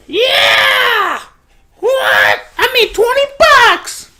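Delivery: high, loud shouts of glee; hyped shouting at the mic; excited shouting. Real, not acted. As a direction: yell it out, thrilled over twenty bucks. Celebrating twenty dollars.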